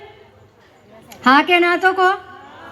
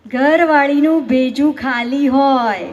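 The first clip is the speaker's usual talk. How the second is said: slow punchline delivery; slower than usual. Acted.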